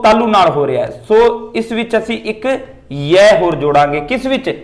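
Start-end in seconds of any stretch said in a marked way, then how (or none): none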